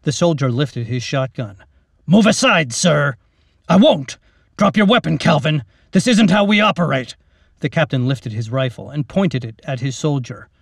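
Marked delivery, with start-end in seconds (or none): 2.1-3.2 defiant. Acted. Soldier defying his captain. 3.7-7.2 loud, firm commanding voice; captain ordering his soldier to drop his weapon; stern order. Acted.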